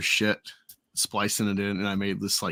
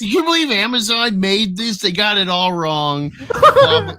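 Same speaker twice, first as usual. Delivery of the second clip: higher, louder indignant fan voice; outraged fan; 11 semitones higher, 9 dB louder than usual. Retold or imitated. Fan fuming about the Amazon adaptation, higher and louder. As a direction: complain like an outraged superfan. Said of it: "who's like".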